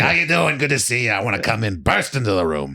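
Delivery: hammed-up confident character voice; Harvey Fierstein. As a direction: sweep in like a brash stage star. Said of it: "I love doing Harvey Fierstein".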